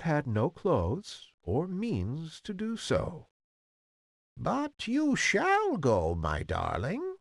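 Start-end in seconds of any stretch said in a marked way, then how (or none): 4.3-7.2 put-on high, slow, swooping old crone; croaking old woman. A narrator's reading. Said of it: "in a feeble, croaking voice".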